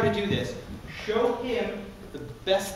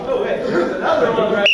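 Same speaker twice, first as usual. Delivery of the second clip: alarmed; 2 semitones higher, 8 dB louder than usual. Acted. Alarmed actor on set.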